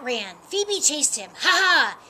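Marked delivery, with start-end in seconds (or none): 1.4-2.0 high, loud teasing "ha ha"; playful mock-laughing cat, pitched up; laughing taunt. A narrator's reading. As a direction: taunt them with a storybook laugh. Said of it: "laughed the tabby cat".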